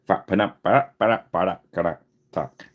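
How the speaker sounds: "Fa-pa-da-pa-da-pa-da-pa-da-ka-da-tak" as a tongue-twister, landing on the final "tak"; garbled pronunciation attempt. Real, not acted. Having another go at the show's name, garbled pronunciation of "Fa-pa-da-pa-da-pa-da-pa-da-ka-da-tak". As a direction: rattle off "Fa-pa-da-pa-da-pa-da-pa-da-ka-da-tak" as a string of stuttered nonsense syllables. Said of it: "I could say it again".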